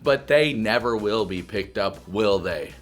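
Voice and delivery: low, soft mock-profound voice; pondering life, hushed; mock deep musing.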